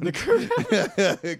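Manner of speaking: slow, drawn-out laughing echo. Real, not acted. Echoing her co-host's dark joke, slow and laughing. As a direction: savor the joke through a laugh.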